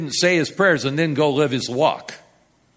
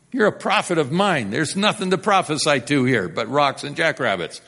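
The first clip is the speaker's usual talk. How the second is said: ordinary talk, nothing marked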